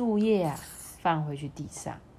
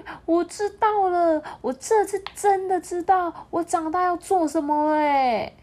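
put-on high, excited child voice; little chameleon calling to mom; calling out eagerly; 10 semitones higher, 4 dB louder than usual. A narrator's reading. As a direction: shout the news while running. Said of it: "一直喊".

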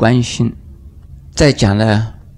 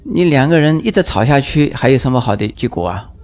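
ordinary talk, nothing marked

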